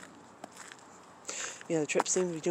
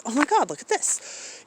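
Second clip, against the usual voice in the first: high-pitched imitation of a thrilled recipient; super appreciative; 10 semitones higher, 4 dB louder than usual. Artist friend showing off his postcard, super appreciative. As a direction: gush in awe like someone showing off a gift. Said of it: "he's super appreciative".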